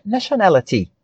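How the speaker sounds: "nationality" in British English with a crisp T; British-style "nationality", the T fully sounded; British pronunciation. Shown as a demonstration. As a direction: say "nationality" the British way, ending in a clear "-tee". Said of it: "pra pegar a pronúncia britânica".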